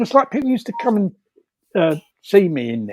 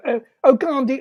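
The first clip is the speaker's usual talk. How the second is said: calling the dog; 8 semitones higher, 4 dB louder than usual. Retold or imitated.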